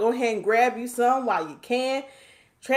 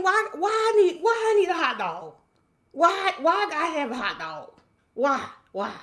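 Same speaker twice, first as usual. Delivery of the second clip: mock protest; 7 semitones higher than usual. Acts out protest over hot dog.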